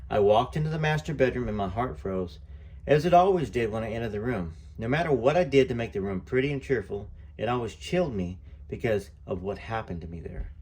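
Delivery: plain reading voice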